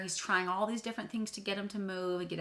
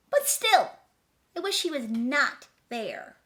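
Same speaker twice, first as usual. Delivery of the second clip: high, loud, sing-song rhyming read; dramatic storybook reading; 6 semitones higher, 9 dB louder than usual. A narrator's reading. Reading the picture book to children, dramatically. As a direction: read the rhyme big for kids.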